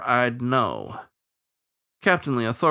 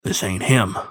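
sepulchral bloodhound voice; grave hound stating it with finality; 3 semitones lower, 3 dB louder than usual. A narrator's reading. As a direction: say it as a solemn, tomb-voiced hound. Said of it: "into his sepulchral voice".

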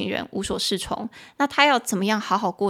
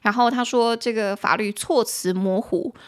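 ordinary talk, nothing marked

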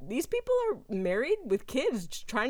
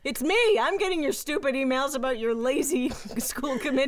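a hint of a fed-up stranger, a bit higher; lightly mock the irritated namesake; annoyed stranger; 4 semitones higher, 4 dB louder than usual. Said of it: "finally be like".

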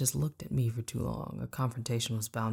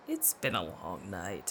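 low, soft, slow weary friend; drained, slow, low; Sade's tired voice; 6 semitones lower, 7 dB quieter, slower than usual. A narrator's reading. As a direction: sound worn out.

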